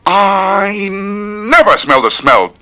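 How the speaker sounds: slow, squeaky high voice; a drawn-out falsetto line. Shown as a demonstration. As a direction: stretch it out up high.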